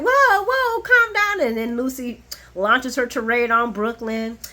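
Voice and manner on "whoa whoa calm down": high, loud whoa; alarmed calming shout. Retold or imitated. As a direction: call out to calm her. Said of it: "everybody's like".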